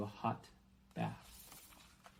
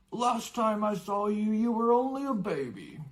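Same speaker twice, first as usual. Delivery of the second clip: higher, louder giant's fond greeting; a big friendly giant remembering; hearty giant voice; 11 semitones higher, 6 dB louder than usual. Acted. Giant recalls Harry as baby.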